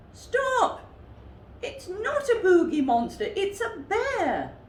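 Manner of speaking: high, loud cry to stop; exclaiming bear. A narrator's reading. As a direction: shout to halt the gang. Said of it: "exclaimed the one with".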